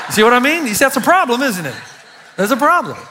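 amused, laughing aside to the congregation. Real, not acted. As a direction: chuckle while asking the room. Laughing at own joke.

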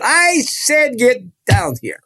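high, insistent call from the stage; commanding preacher. Retold or imitated. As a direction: order the boy down before the crowd. Evangelist calls the boy down.

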